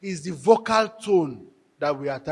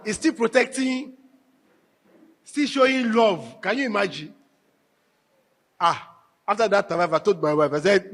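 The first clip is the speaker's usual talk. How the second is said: ordinary talk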